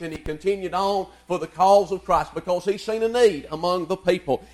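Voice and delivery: ordinary talk, nothing marked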